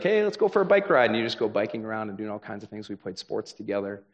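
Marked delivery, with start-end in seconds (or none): 0.0-1.1 high, loud, bright invite to a buddy; eager kid friend. Retold or imitated. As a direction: call out to a friend like a kid again. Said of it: "so it'd be like".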